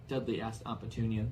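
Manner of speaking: usual speaking voice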